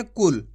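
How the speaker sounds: कुल said quickly with a short u; quick short vowel. Shown as a demonstration. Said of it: "जल्दी से उच्चारण कर देंगे".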